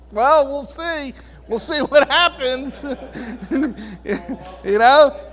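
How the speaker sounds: high, loud laughing carefree reply. Retold or imitated. Pastor retelling how he laughs at his bills, laughing. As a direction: laugh off the bills without a worry. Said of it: "I'm probably laughing at 'em".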